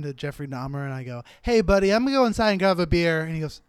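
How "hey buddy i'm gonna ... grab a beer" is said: higher, louder, fast nervous bail-out; nervous excuse. Retold or imitated. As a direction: hurry away from a scary guy with fake cheer. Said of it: "I'm scared".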